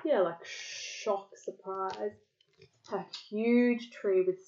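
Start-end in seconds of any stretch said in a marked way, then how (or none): none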